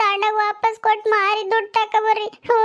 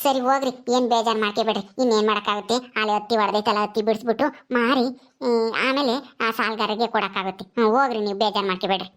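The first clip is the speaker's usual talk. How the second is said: put-on soothing older mother; 8 semitones lower than usual. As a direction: hush her gently like a village mother. Mother figure reassures son.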